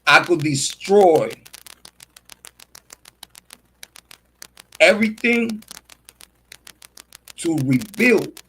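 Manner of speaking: a lightly done mogul proclamation, unhurried; CEO voice. Acted. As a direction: play the visionary CEO, weighing every word. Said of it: "I could talk like this".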